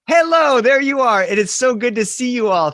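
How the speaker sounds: high, loud and bright hello; excited greeting. Real, not acted. Teacher greeting the students on the Zoom, high and excited.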